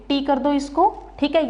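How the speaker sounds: ordinary talk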